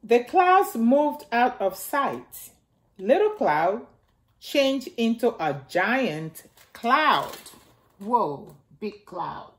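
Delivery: gentle storybook reading for little ones; reading to children. Acted. Miss Nana reading Little Cloud aloud to the children, storybook voice. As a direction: read it out like story time for kids. Reads picture book to children.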